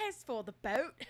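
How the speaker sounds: put-on British accent breaking into laughter; cracking up mid-accent; laughing through British accent. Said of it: "just tried British accent".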